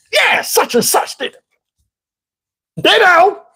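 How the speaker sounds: high-pitched mocking voice; irritating woman. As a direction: butt in like an annoying know-it-all. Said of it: "voice to people that irritate me".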